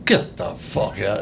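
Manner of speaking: quiet, incredulous New York Italian gangster. Acted. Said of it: "talk like Italian gangsters".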